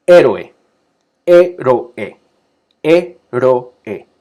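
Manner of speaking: héroe said loud with e and o apart; loud vowel demo. Shown as a demonstration. Teacher drilling héroe for the class, loud and clear. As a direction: push héroe out loudly, keeping the vowels separate. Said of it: "Dos vocales".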